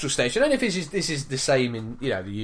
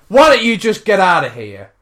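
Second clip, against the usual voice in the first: loud, heated challenge; raised-voice indignation, re-enacted; retold angry retort; 9 dB louder than usual. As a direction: throw it out as a roadside dismissal. Woman's angry reply acted out.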